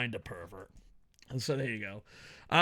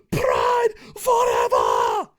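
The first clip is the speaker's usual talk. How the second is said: loud, high yell with each word stretched long; yelling, more drawn out; 8 dB louder, much slower than usual. Acted. Nostalgic reviewer cheering for old Pride, long drawn-out yelling. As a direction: scream it very slowly, word by word. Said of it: "in different ways".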